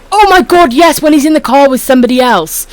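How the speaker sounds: high, loud burst of delight; excited. Real, not acted. To chat, excitedly recognising the favourite turkey video. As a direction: squeal with recognition.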